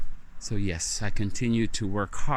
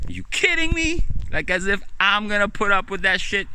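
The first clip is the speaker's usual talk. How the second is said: much higher and louder, scoffing in disbelief; outraged shout; 11 semitones higher, 4 dB louder than usual. Acted. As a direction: blow up at the very idea. Ranting about the job.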